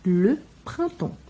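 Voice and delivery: printemps said very slowly, ps dropped; teacher recapping printemps slowly; silent ps. Shown as a demonstration. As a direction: say printemps with two nasal vowels and a silent ps. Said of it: "o P S vai desaparecer no som".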